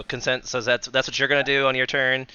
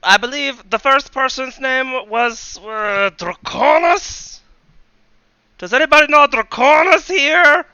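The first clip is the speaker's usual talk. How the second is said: very high, loud radio villain in mock Russian; slow, hammed-up Russian villain; wobbly Russian accent; 12 semitones higher, 7 dB louder than usual. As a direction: taunt the players in a bad Russian accent. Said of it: "what's going on with my accent".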